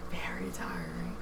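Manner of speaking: hushed, voiceless aside; very soft whisper; whispered echo. Real, not acted. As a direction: whisper it under your breath. Echoes own remark in a whisper.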